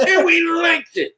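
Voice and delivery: put-on high, far-off cranky old man; old-timer echo. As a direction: repeat it as the griping old-timer. Echoing the old-timer bit.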